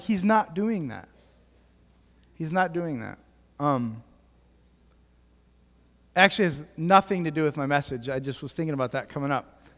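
normal talking voice

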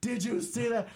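high thrilled shriek; ecstatic disbelief. Retold or imitated. Strangers hugging after the kick, thrilled high squeal. Crowd watching winning kick.

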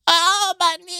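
high, whiny mock cry of pain; teasing friend mocks a knee injury with a whine; mock whining. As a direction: whimper it like a wounded baby. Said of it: "All I heard ... was".

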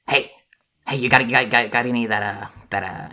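a swarm of squeaky rats talking; squeaky rat voices. Acted. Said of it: "like a legion of squeaky rat voices".

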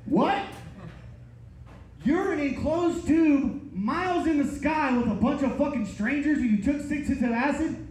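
can't believe what he's hearing; incredulous. Retold or imitated. Shocked reply to acid confession.

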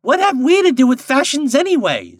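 very high, loud indignant screech; screaming linnet. A narrator's reading. Linnet protesting about fashion, screaming. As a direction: scream it out as a small bird. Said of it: "screamed a linnet".

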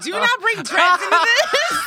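high, loud indignant order; indignant outburst. Retold or imitated. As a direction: snap it out indignantly. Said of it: "I was like".

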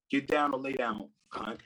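ordinary delivery

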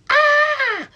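short piercing "Ah!" scream, very high and loud; high-pitched scream demo. Shown as a demonstration. Birth teacher demoing a tense high scream. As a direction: let out a sharp high scream. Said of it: "kind of scream".

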